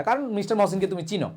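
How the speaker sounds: usual speaking voice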